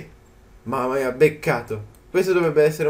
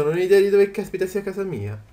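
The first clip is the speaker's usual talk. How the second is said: usual speaking voice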